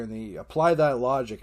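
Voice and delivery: usual speaking voice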